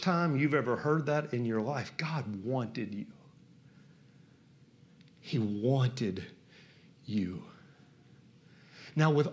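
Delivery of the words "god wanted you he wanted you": low and soft, spaced out slowly; slow, tender near-murmur; hushed, heartfelt. Real, not acted. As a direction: let each word land quietly, low. Quiet emphatic repeat of the point.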